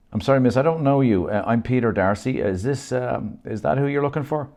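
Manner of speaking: politely confused on the phone; puzzled stranger. Acted. Wrong Peter replies.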